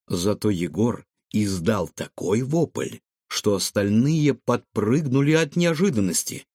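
ordinary delivery

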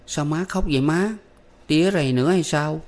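put-on boy asking his crying mother, worried child voice; worried young boy. A narrator's reading. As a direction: ask mother why she cries, anxious.

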